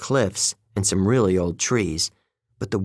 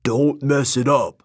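warning; 4 semitones higher than usual. Acted. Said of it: "Beckendorf warned me".

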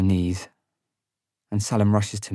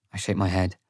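ordinary delivery

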